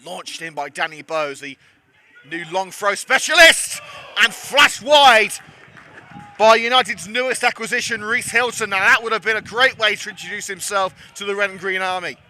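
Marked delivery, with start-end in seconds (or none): 2.3-12.2 rising to a loud, excited shout on the chance; excited commentary. Real, not acted. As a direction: call the near miss with a burst of excitement. Near miss in live commentary.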